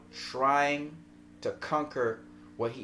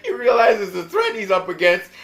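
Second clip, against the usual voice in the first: higher and louder, laughing through it; 8 semitones higher, 12 dB louder than usual. Real, not acted. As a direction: talk through the giggles. Still laughing from his story.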